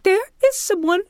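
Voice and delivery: imitating a friend in a squeaky-high voice; really high-pitched imitation. Frightened houseguest reporting an intruder, high-pitched imitation. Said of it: "she talks like that really high pitch".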